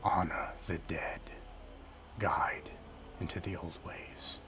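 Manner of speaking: whispering ancient tree, fading out; a dying tree spirit giving last words, breathy; talking tree. Acted. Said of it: "And the tree says".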